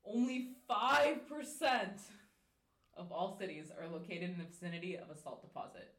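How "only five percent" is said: "five" drawn out slowly and loud; stress on five. Shown as a demonstration. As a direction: hit "Only five percent" slowly. Fun fact delivery.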